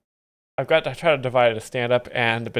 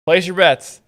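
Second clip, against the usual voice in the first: higher, louder betting call; raised announcer-like call to the punters; calling for bets; 4 semitones higher, 6 dB louder, slower than usual. Pretend. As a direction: call out for wagers like a croupier. Calls for bets like a croupier.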